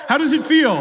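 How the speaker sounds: high, excited shout to the crowd; crowd-rousing holler. Real, not acted. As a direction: shout it out to fire up the room. Rousing the live audience.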